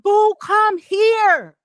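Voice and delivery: high, loud, slow drawn-out summons; mock stern husband voice calling boo; husband's stern call. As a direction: call her over like a displeased husband. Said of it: "He said".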